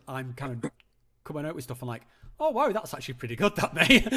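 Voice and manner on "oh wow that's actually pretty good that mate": higher, louder, quick mock amazement; pleasantly impressed. Streamer telling caller how his own waffling surprises him, pleasantly impressed. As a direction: act out being impressed with yourself.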